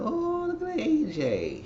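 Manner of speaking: high, slow pitying "oh"; sympathetic coo. Retold or imitated. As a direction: coo over him like a hurt child. Cooing at sad A J.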